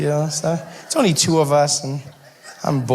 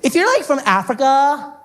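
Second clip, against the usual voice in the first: mock ditzy girl; 6 semitones higher than usual. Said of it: "she said".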